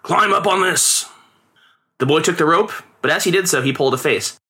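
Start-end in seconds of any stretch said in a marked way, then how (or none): 0.0-1.1 put-on higher, slow command to the boy; lightly voiced father; father instructs. A narrator's reading. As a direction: hand it over firmly. Father hands rope to boy.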